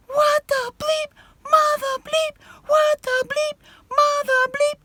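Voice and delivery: very high, loud shrieked curses; reenacted screaming attack on the van intruder. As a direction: shriek it like the Psycho shower scene. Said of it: "wailing and screaming".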